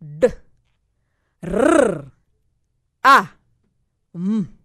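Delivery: "D, R, A, M" as separate letter sounds with long pauses, R rolled. Shown as a demonstration. Teacher splits drum into four sound blocks, "D, R, A, M" sounded out singly with rolled R. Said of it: "I repeat".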